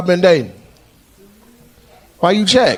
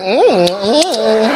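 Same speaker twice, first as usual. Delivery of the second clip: mock savoring hum; 3 semitones higher, 5 dB louder, much slower than usual. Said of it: "He like".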